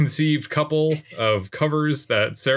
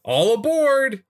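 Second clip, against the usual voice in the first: slow, much higher Texan drawl calling all aboard; hammed-up Texan conductor; 14 semitones higher, 3 dB louder, slower than usual. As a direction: call out like a cartoon train conductor. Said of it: "we are gonna do the whole episode like this".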